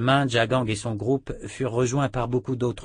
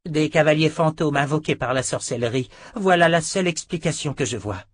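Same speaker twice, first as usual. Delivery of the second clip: higher, louder woman's voice; Perdita, urgent; 7 semitones higher, 5 dB louder than usual. A narrator's reading. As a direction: press your explanation on the emperor. Sister Perdita explains to emperor. (sœur insistante, voix aiguë et forte)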